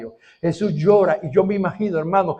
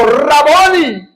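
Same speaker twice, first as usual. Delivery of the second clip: awed recognition; 11 semitones higher, 10 dB louder, much slower than usual. Acted. Mary recognizes her master.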